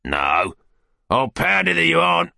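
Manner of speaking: sour, sardonic retort, rising high; bitter grin. A narrator's reading. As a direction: answer bitterly through a grin. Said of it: "replied Sikes with a bitter grin".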